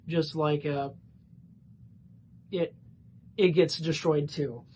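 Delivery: halting, stumbling stutter. Real, not acted. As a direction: trip over the words while stuttering. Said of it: "Sorry for the stuttering there".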